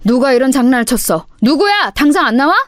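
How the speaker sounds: loud, high furious scolding; enraged homeroom teacher shouting; angry teacher. Acted. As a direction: demand the culprit come forward. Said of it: "크게 화가 나서".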